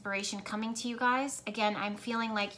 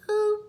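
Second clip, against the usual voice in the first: a high, loud sonar ping; sonar boop; 12 semitones higher, 10 dB louder than usual. Retold or imitated. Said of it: "like that sonar".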